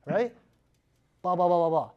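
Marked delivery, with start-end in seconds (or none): none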